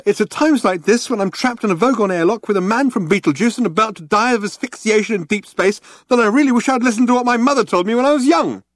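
high, worked-up complaint; exasperated lament. A narrator's reading. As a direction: build from rueful musing to frantic regret. Arthur's doomed rant builds.

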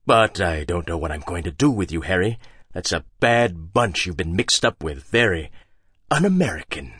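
low, soft, disapproving; a hint of a scolding father; disappointed father. A narrator's reading. Hagbard playing Harry's father, mildly disappointed.

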